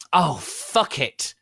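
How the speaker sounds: angry shout. Acted. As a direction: shout it in fury. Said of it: "Vampire shouted angrily".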